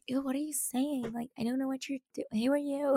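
hushed, disdainful ballet teacher; soft mock disgust. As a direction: quote a snooty teacher under your breath. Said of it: "the ballet teacher was like".

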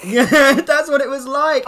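giggling agreement; real laughter in the voice; laughing. Real, not acted.

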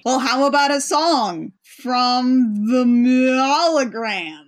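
slow, drawn-out showman's announcement; hammed-up captain. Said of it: "the captain asks the holograms to perform".

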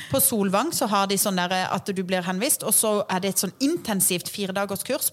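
normal talking voice

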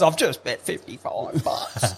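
slow, soft, laughing admission; laughing confession. Retold or imitated. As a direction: confess it through a laugh, slowly. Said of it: "He goes".

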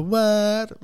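loud, high-pitched hype call; catchphrase shout. Shown as a demonstration. Host showing off his podcast catchphrase, shouted. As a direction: belt out the catchphrase word.

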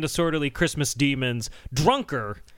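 normal talking voice

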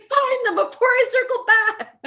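high loud call over the water; dad shouting. Retold or imitated. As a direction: yell it from the boat. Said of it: "he was like".

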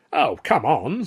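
loud, high protest; sticking up. A narrator's reading. Fellow comic to the manager, sticking up for his friend. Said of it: "sticking up for me".

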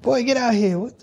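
high-pitched mock dad, baffled and scolding; imitating his dad. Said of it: "he's like".

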